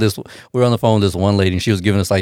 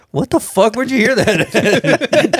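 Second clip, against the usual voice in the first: high, slow puzzled question; shocked wife; 10 semitones higher, much slower than usual. Retold or imitated. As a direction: drag it out in high disbelief. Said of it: "she was like".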